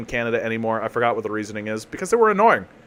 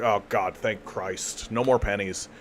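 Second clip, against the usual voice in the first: relieved cashier; 5 semitones lower, 7 dB quieter, slower than usual. Retold or imitated. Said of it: "literally is just like".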